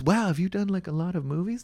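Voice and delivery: high-pitched admiring question; imitated impressed girl.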